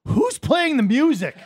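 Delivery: loud, high, drawn-out mock yell; angry coach. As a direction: imitate an irate coach yelling over the glass. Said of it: "he was angry".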